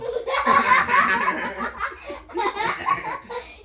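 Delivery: high squealing laughter; shrill loud laugh. Real, not acted. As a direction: burst into a shrieking laugh. Tertawa keras. (tawa melengking keras)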